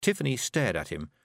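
plain reading voice

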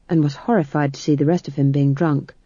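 plain reading voice

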